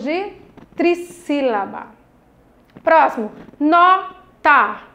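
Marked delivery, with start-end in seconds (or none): 3.6-4.8 two slow, separate syllables. Shown as a demonstration. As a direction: leave a gap between the two pieces. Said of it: "Dois pedacinhos".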